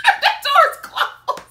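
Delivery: high, loud, slow words through laughter; laughing hard. Real, not acted. Storyteller losing it at the punchline, high laughing delivery.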